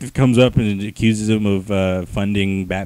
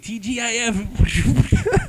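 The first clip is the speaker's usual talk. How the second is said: mock TGIF; 11 semitones higher, much slower than usual. Mock weary quote.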